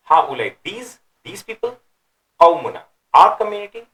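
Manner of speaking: right reading, rising with surprise; exclamatory, questioning tone. Shown as a demonstration. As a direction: exclaim it in disbelief and scorn. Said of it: "a questioning, exclamation, surprise".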